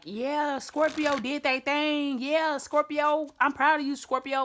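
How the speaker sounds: high, loud, slow proud cheer; cheering crowd voice. Retold or imitated. Reader cheering on Scorpio, imitating proud admirers. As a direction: gush over Scorpio like a proud fan. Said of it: "people like".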